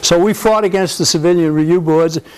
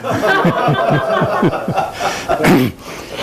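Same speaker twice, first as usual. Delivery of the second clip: amused laugh. Real, not acted. Amused at the brat story.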